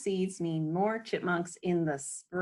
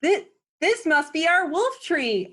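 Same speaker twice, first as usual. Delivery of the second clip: high, loud, thrilled discovery; two kids shouting their big find; excited exclaim; 10 semitones higher, 7 dB louder than usual. Acted. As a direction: burst out with the joy of finding it. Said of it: "Aurora and Orion exclaim".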